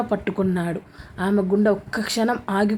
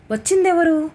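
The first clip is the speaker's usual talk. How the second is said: pitched up, louder curious question; curious high question; 8 semitones higher, 4 dB louder than usual. Acted. Roommate asks who came, pitched up and curious. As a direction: ask eagerly, high and bright.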